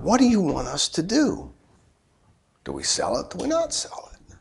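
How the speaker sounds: dropping to a whisper as he prays; hushed prayer. Retold or imitated. Preacher retelling his prayer about the house, hushed and whispered. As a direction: murmur the question to God, fading to a whisper.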